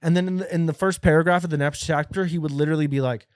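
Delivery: ordinary talk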